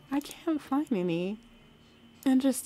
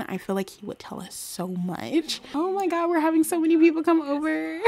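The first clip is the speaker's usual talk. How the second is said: ordinary delivery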